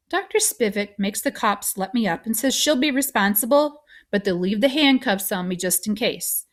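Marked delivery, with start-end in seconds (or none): none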